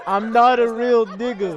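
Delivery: put-on high, loud squeaky parroting of the kid; mocking squeaky echo. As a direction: squeak the line back like a whiny kid. Echoes clip line mockingly, laughing.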